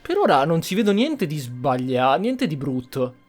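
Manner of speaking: usual speaking voice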